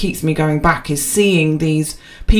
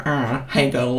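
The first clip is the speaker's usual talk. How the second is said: mock flirty guy; 4 semitones lower, 7 dB quieter than usual. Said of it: "where they lick their lips and then go".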